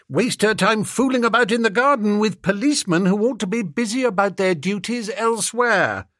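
a peeved aristocrat scolding; lightly voiced snooty toff. Bertie scolds maid.

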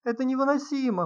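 high-pitched anguished wail; moaning. A narrator's reading. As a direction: moan it out, pitch climbing high. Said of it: "простонала Прасковья Фёдоровна".

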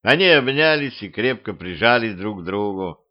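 plain reading voice

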